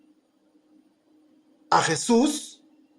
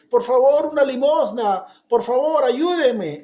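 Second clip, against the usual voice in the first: louder, high pleading beggar; begging; 6 semitones higher, 6 dB louder than usual. Retold or imitated. Blind beggar asking passers-by for alms, pleading. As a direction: plead for a coin.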